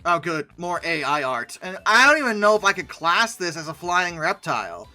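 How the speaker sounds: ordinary talk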